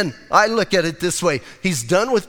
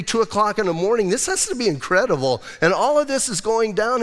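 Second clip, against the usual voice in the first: normal talking voice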